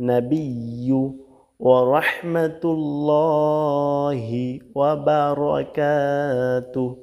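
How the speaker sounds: long drawn-out syllables, measured recitation; slow Quranic recitation. Shown as a demonstration. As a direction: recite it slowly, stretching every long vowel. (ধীরে টেনে টেনে তিলাওয়াত)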